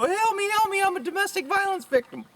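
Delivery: high, pleading mock-victim voice; hammed-up damsel plea; mock helpless victim. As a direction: whine for rescue in a put-on high voice. Mocks a victim pleading with cop.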